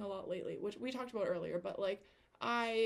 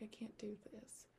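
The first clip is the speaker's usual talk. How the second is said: whisper; 13 dB quieter than usual. Retold or imitated. Inner thought quoted in whisper.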